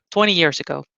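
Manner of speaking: ordinary talk, nothing marked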